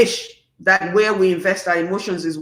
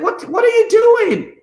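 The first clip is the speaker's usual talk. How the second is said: high, loud, slowed-down "what are you doing"; exasperated disbelief; 13 semitones higher, 4 dB louder than usual. Real, not acted. To people posting online, high exasperated disbelief. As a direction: stretch it out, baffled and appalled. Disbelief at social media posts.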